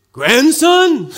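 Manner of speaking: loud, high mock-grandpa call; grandpa in character. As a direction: play along as a delighted fake grandpa. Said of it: "in, in character".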